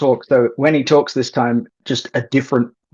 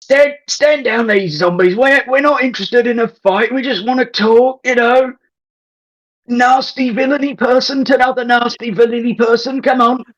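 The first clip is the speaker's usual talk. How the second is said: higher, throaty bad Aussie troll accent; 10 semitones higher than usual. Player as troll coaxing villain to talk, bad Aussie accent. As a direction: coax him out in bad Aussie. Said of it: "bad accent. Bit throaty".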